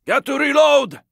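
ordinary delivery

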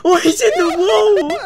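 high, loud disbelieving yell; shocked cry. Real, not acted. Spotting a teammate stuck in the wall, shocked cry.

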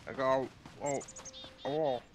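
talking around a bottle in mouth. Real, not acted. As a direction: speak with a bottle between the lips. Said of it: "Got a bottle in my mouth".

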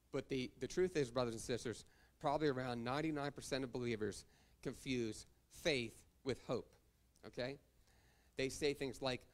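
usual speaking voice

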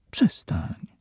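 hushed stop, pushing his hands away; murmured. A narrator's reading. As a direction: breathe it out, barely voiced. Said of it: "mruknęła".

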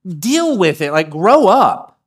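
loud, slow, drawn-out scolding; stern in-your-face dad. Retold or imitated. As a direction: get in his face and tell him to grow up. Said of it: "somebody who got in my face and said".

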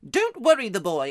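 loud, high aunt cutting in; Aunt Letty. Acted. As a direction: shut him up, as a boy mimics it. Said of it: "She always shuts him up".

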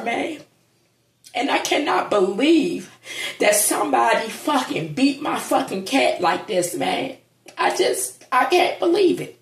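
high, strained voice on the edge of crying; real crying over his dead cat; fighting back tears. Real, not acted. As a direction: voice breaking as he tries not to cry. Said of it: "I'm trying not to cry".

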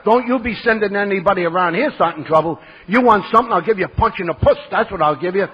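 loud, tough telling-off; indignant warning with punched-out words; angry retort. Retold or imitated. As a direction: threaten him down the phone, loudly. Acted angry threat into the phone.

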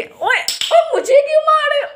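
very high yelping protest; high shocked yelp at being hit; startled protest. Real, not acted. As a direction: squeal it as if suddenly hit. Son hits her during game.

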